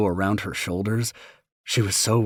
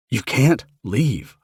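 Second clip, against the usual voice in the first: pleading; 4 semitones higher, 6 dB louder, much slower than usual. Retold or imitated. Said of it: "I pleaded".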